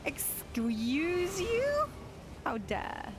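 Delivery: high, very drawn-out rising question; mock indignant. Streamer told her British voice triggers someone, mock indignant. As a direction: stretch it out in affronted disbelief. Indignant reply to chat's complaint.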